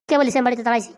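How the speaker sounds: ordinary talk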